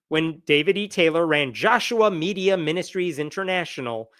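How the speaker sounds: normal talking voice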